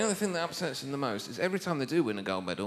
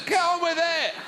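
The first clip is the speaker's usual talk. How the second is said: much higher, louder impatient yell; hammed-up barracking; mock heckle; 12 semitones higher, 3 dB louder than usual. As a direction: shout at the telly. Heckling the luge.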